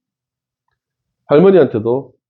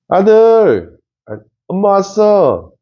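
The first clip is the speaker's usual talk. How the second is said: cheerful mom greeting; 9 semitones higher, slower than usual. Shown as a demonstration. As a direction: greet your son like the happy mom in a drama. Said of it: "이-, 이렇게 좀".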